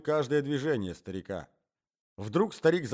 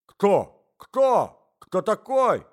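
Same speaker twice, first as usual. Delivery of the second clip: loud, high alarmed questions; agitated loud cries; shouting old man; 8 semitones higher, 10 dB louder, much slower than usual. A narrator's reading. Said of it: "кричал старик".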